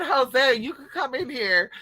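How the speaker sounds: calling out. Retold or imitated. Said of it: "I said".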